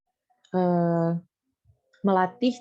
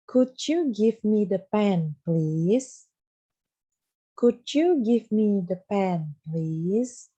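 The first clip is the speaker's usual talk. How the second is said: slow model sentence; much slower than usual. Shown as a demonstration. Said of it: "Ada yang tahu gimana?".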